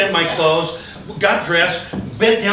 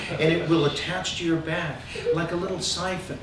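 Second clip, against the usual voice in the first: soft calm explanation; 6 semitones lower, 10 dB quieter than usual. Retold or imitated. Healer explaining cupping softly.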